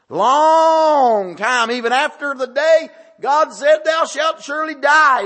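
high, loud preaching climb; rising preacher fervor. Real, not acted.

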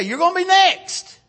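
high, loud scolding warning; parent's threat. Acted. Parent warns the child who laughed, loud threat. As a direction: call it out sharply at the laughing child.

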